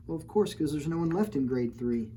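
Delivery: ordinary delivery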